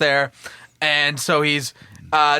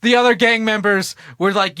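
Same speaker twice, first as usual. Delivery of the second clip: ordinary talk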